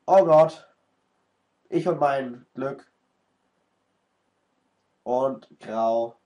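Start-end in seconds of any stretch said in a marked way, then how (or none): none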